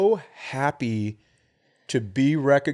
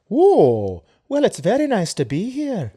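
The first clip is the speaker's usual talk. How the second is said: high-pitched imitation of an older-style accented lady; [name] accent; 11 semitones higher, 3 dB louder than usual. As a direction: put on her accent, pitched way up. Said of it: "Did she have an accent like that?".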